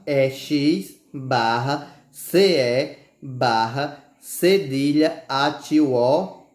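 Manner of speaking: slow letter-by-letter spelling. Shown as a demonstration. Teacher spelling exceção slowly for pupils. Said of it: "Exceção".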